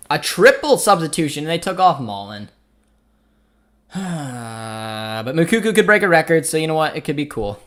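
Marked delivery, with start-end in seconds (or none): 3.9-5.2 heavy, drawn-out sigh; real sigh, slowly let go; long sigh. Real, not acted. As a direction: let out a long, soft breath. Reacts to the substitution.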